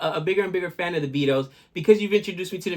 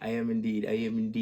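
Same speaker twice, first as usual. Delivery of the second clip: low, soft, solemn watchman voice; low deadpan watchman character; night watchman voice; 8 semitones lower, 7 dB quieter than usual. Acted. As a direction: play the grave night watchman, lower and softer. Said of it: "Gabe, the Night Watchman".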